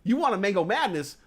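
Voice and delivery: ordinary delivery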